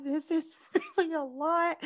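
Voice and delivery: high, soft, mock-tearful voice; overwhelmed. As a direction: let the voice climb as if it is too much. Own overwhelmed reaction, retold.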